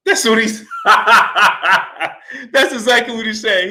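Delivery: talking through real laughter; laughing. Real, not acted. Host cracking up after his Manny impression, laughing. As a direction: giggle while confirming the quote.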